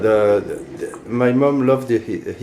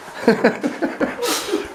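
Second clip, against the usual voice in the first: high laugh; 5 dB quieter than usual. Real, not acted. Laughing at speaking English.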